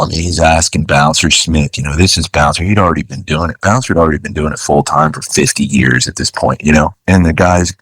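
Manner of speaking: usual speaking voice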